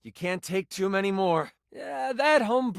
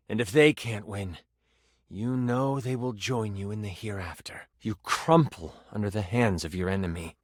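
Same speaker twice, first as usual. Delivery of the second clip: low, grave storytelling with pauses; solemn death-scene narration; 9 semitones lower than usual. Acted. Game master narrates a player's fall, low and solemn. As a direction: let each phrase sink low and heavy.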